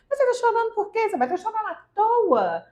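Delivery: dismissive adult. Retold or imitated. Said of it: "algum adulto vira e fala assim".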